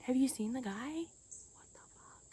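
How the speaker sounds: hushed, incredulous whisper; whispered disbelief about Jack Nicholson. Real, not acted. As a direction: breathe it like a shocked aside.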